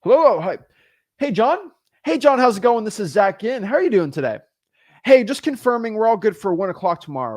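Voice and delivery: peppy confirmation call; upbeat phone greeting. Acted. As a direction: greet the seller brightly on the phone. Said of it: "I'm gonna call the seller up. I'm gonna say this".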